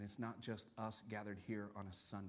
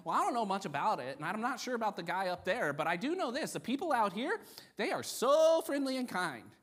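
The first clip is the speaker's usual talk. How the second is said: higher, louder, gushing praise; enthusiastic visitor; 11 semitones higher, 10 dB louder than usual. Retold or imitated. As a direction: gush loudly like an impressed newcomer. Acting out first-time church visitors.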